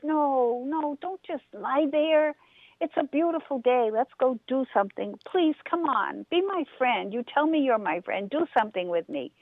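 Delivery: a restless bunny begging his friend; pleading rabbit. Acted.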